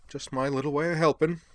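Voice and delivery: chirpy and self-pleased; a light eccentric gentleman; jaunty Devlin voice. Acted. Devlin's dialogue.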